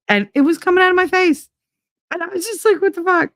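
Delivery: high, fast shocked outburst; shocked disbelief. Retold or imitated. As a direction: squeal it like you just found it on your face. Retelling shock at hair on face.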